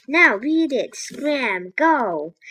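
loud, punchy string of orders; yelling. A narrator's reading. Jack chases the octopus off, loud yell. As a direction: yell each command, bursting out high and loud. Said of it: "Jack yelled at it".

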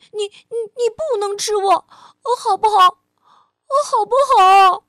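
high, loud pleading squeal; shrieking. Acted. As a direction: shriek and beg for your life. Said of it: "棘龙宝宝尖叫着".

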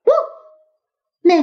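slow little woof; a puppy's bark; dog bark. Acted. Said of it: "Max叫了一声". (小狗汪汪叫)

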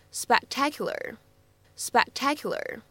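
"spectacular" with the first c only held, not released; "spectacular" with an unreleased c; lost plosion demo. Shown as a demonstration. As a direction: say "spectacular" swallowing the c before t. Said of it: "失去爆破的处理".

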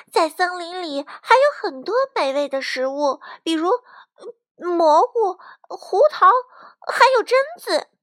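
put-on young negotiator. A narrator's reading. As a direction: list tempting foods to win them over. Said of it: "卡门接着说". (用稚嫩高声劝说)

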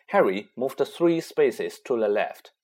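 usual speaking voice

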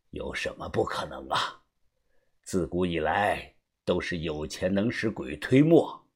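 low, hushed character voice; soft, low older mentor sharing a secret; knowing elder, assured. Acted. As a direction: explain it quietly and with certainty. Voices Uncle Xu explaining.

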